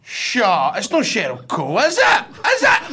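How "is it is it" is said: high-pitched incredulous question; stunned, squeaky disbelief; real astonishment. Real, not acted. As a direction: blurt it out, voice leaping high. Guessing who the mystery guest is.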